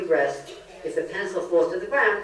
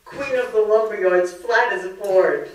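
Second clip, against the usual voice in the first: put-on higher, louder wailing girl's complaint; a wailing kid sister, pitched up and loud; upset twelve-year-old; 5 semitones higher, 8 dB louder than usual. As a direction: whine it like a hurt twelve-year-old to her big sister. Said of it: "And I said".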